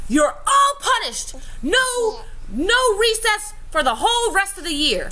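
mock-stern teacher scolding the whole class; imitated stern teacher. Retelling a teacher punishing the whole class, lightly voiced scolding. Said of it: "the teacher turns around and says".

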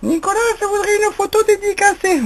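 very high pretend voice for the pet; a very high squeaky pet voice asking Nicolas a favour; voicing Bernard. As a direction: speak for the bird in a very high put-on voice. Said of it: "dis quelque chose à Nicolas".

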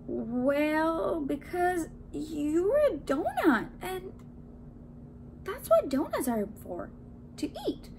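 slow, high, halting explanation; hesitant explaining. A narrator's reading. Man explains what donuts are for, hesitant. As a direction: feel your way through it, ending high.

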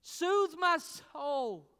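high, loud, slow plea; soul's cry. Pretend. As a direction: cry out for comfort. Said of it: "your soul says".

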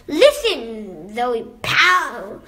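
ordinary delivery

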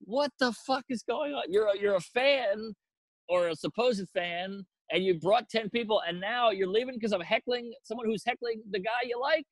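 high-pitched, indignant disbelief; incredulous argument. Retold or imitated. Acted quarrel with departing fan.

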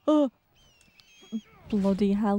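ordinary delivery

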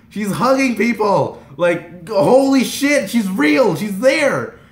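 high, loud starstruck outburst; screaming. Retold or imitated. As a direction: cry it out, amazed your idol is real. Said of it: "I was screaming".

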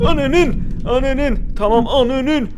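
high, loud startled cursing; startled yelling curse. Real, not acted. Player startled by the eel, yelling a curse loud and high. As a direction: yelp it out as the eel lunges.

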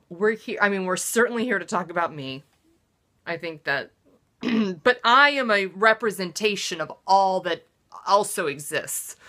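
usual speaking voice

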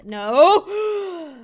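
very loud, high, drawn-out wail; exasperated yell. Real, not acted. Player cut off by escaping cows, exasperated yell. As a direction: burst out in dismay.

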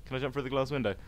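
usual speaking voice

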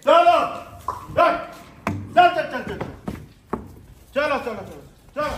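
high, very loud commands; loud herding shout. Real, not acted. Keeper herding lions into place, shouting high and loud. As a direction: drive the lions along.

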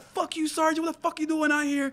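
high-pitched jeering voice; re-enacted high scolding; mock chewing-out. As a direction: imitate corporals heckling a sergeant. Re-enacts corporals chewing him out.

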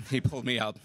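ordinary talk, nothing marked

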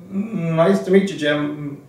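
high, loud mimicry with a drawn-out hum; Mike Jacobstein. Librarian greets a young applicant, high mimicked voice. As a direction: imitate the mentor, pitched well up. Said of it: "he speaks like that".

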